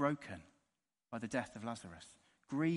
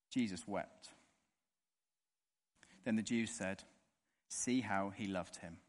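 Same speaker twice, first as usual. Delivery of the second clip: measured, steady Bible reading; scripture reading; 4 semitones lower, 4 dB quieter than usual. Acted. Preacher reading John to the congregation, steady scripture reading. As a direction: read the passage aloud to the congregation.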